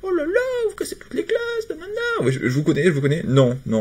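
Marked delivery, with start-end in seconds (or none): 0.0-1.9 very high, whiny mock complaint; squeaky sarcastic impression of upset players. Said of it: "Il y en a qui vont dire".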